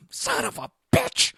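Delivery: very high, drawn-out strained cry; exasperated outburst. Retold or imitated. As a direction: stretch the curse out in high-pitched frustration. Retold discovery of cat in ceiling.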